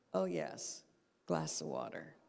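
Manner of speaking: low-pitched note to self; self-reminder. Shown as a demonstration. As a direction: act out remembering, dropping the pitch at the end. Acts out a mental self-reminder.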